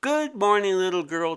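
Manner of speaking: high, loud little-creature voice; sly creature calling. Acted. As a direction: call down from the windowsill, teasing. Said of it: "Tai Tu called from the windowsill".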